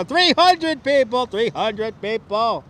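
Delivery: slow, stretched celebratory announcement; drawn-out victory cheer; triumphant call. Real, not acted. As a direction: cry it out high, then ease down. Celebrates reaching the like target.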